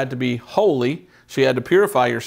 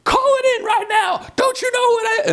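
high-pitched, indignant scream imitating an irate driver; hammed-up furious screaming; angry screaming. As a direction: scream it in mock outrage, pitch way up. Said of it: "was all angry and screaming".

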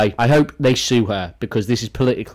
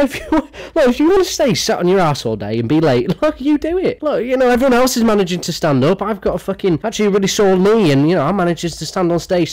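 higher, sarcastic diva scolding; 7 semitones higher than usual. Retold or imitated. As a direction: parody her guilt-tripping the fan. Parodying [name] scolding seated fan.

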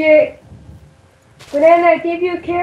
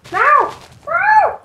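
alarm shout; 10 semitones higher than usual. Real, not acted. Player attacked in game.

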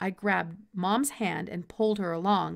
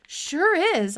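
excited; 9 semitones higher, 8 dB louder than usual. A narrator's reading. Boy excited.

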